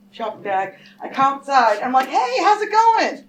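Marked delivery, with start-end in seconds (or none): 2.1-3.2 high, slow, put-on breezy hello; fake casual greeting. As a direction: sound overly friendly to cover alarm.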